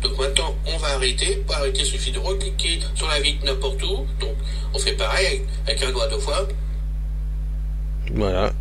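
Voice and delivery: played back through a phone speaker; distant tinny playback; dictaphone recording. Real, not acted.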